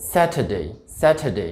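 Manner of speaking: স্যাটারডে as "SAT-uh-day", no r; correct British pronunciation. Shown as a demonstration. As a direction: model স্যাটারডে, crisp t, silent r. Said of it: "শনিবারের উচ্চারণটা হবে".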